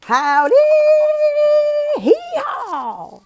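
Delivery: high loud howdy hee-haw with a laugh; cowboy yell. Shown as a demonstration. Teacher demonstrating the cowboy cheer, yelled high. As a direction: holler like a rodeo cowboy. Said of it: "you yell".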